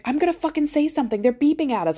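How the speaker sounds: high, indignant outburst; outraged. Retold or imitated. To husband about the honking car, high outraged rant. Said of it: "I'm like".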